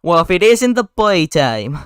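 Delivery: stretched-out, hammed-up New Jersey accent. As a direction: greet them mockingly in broad New Jersey. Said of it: "this is the New Jersey accent".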